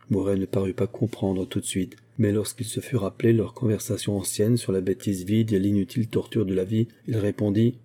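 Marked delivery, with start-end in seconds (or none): none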